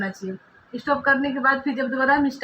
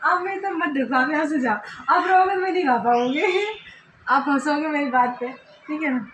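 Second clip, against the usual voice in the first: giggling plea; 4 semitones higher than usual. Real, not acted. Shooing husband away, giggling.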